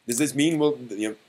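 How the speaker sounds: normal talking voice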